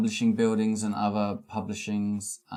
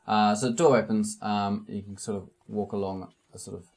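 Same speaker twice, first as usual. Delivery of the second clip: usual speaking voice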